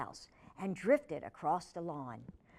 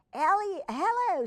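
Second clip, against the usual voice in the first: high, louder friendly hello; cheery greeting; 11 semitones higher, 8 dB louder than usual. A narrator's reading. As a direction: greet the girls brightly. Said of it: "Sam said".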